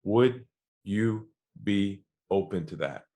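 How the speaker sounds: slow and low, unhurried; a centred salesman's calm invitation; calm low question. Retold or imitated. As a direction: ask it gently, no pressure. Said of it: "lowered my voice".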